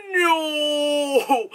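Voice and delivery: sharp high gasp of "no"; high horrified no; horrified cry. Retold or imitated. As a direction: cry out in horror as the audience. Audience reacts to the toy.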